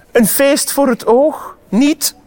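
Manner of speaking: high, showy little boast; calling out. A narrator's reading. Salamander showing his belly to the travellers, high proud call. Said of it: "roept hij".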